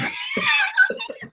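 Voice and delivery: big hearty laugh. Real, not acted. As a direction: crack up hard at your own joke. Laughs at own punchline.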